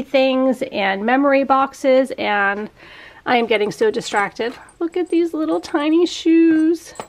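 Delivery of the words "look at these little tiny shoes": high, sweet cooing voice. Real, not acted. Cooing over baby shoes.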